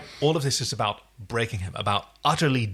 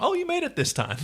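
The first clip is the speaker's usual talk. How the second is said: mock surprise. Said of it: "he did that even when".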